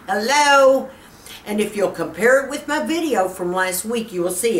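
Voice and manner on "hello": emphatic hello. Real, not acted.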